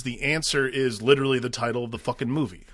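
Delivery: normal talking voice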